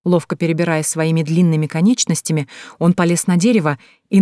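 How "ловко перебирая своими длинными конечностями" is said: ordinary delivery, nothing marked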